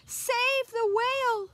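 high, loud plea; urgent call. A narrator's reading. Snail trail message read aloud, high urgent call. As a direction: call out to save him.

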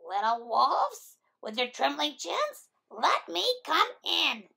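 the big bad pig sweet-talking the wolves through the phone; coaxing pig voice. A narrator's reading. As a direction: coax them out, drawing each word long. Pig coaxes at the door.

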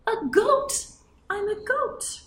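goat. Acted. Animal answer in role.

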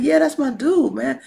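a touch of street-buddy swagger; lightly acted homeboy talk. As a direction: vouch for your dude, loud and casual. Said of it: "I always gotta talk like this".

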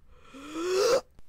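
loud, rising gasp; high startled gasp. Acted. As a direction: suck in a sharp audible breath. Rushes in with discovery.

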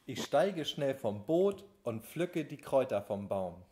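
usual speaking voice

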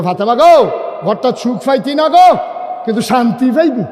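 high, loud calls with falling tails; rousing preaching. Real, not acted. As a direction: call it out to stir the congregation.

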